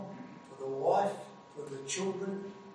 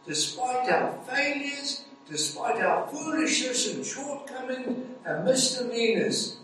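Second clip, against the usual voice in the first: higher and slow, weighing each despite; preaching declaration; 7 semitones higher, slower than usual. Acted. Preacher assuring the congregation of forgiveness, in a slow lifted preaching voice. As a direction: lift the voice and linger on every word.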